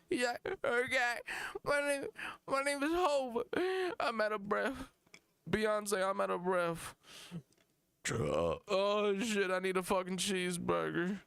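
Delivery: high, winded rapper parody. Acted. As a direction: play a star rapper too out of breath to perform. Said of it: "that's a fucking [name] performance".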